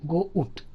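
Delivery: గో ఉట్ in two separate parts, "go, oot"; split diphthong. Shown as a demonstration. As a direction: break the vowel apart in గో ఉట్. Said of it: "అనాలి".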